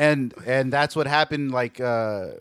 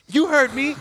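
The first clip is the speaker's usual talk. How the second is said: confrontational retort; 11 semitones higher, 4 dB louder than usual. Retold or imitated. Retold confronting a tagger.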